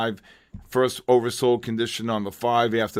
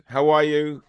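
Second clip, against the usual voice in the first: ordinary talk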